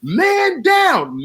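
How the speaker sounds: very high mock scream; piercing retold shout of alarm; mad loud imitated yell. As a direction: holler it high, mimicking her. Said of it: "mad loud".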